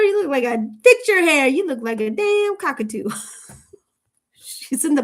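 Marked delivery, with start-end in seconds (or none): 0.0-3.1 high, loud mocking mother-in-law; hammed-up mother-in-law insult; mock scolding mother-in-law. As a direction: sneer at her like a snooty mother-in-law. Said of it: "what does she tell her?".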